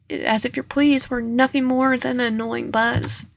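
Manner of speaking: plain reading voice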